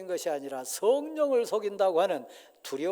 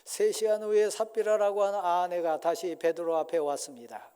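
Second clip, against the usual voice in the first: ordinary talk, nothing marked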